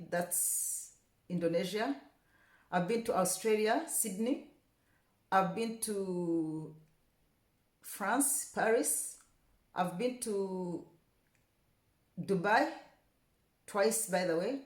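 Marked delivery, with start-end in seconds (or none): none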